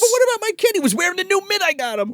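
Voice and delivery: high-pitched mock pleading; hammed-up protest of an arrested dad; desperate father. Arrested father pleads about son.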